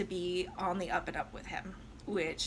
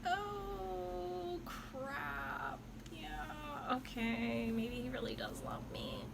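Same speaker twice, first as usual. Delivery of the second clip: softer, higher, resigned murmur; hushed giving in; 5 semitones higher, 9 dB quieter, much slower than usual. Retold or imitated. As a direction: let her melt and give in under her breath. Violetta swayed by his song.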